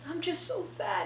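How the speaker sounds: soft mock-sad old man line; imitating a sad old man softly. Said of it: "Y él".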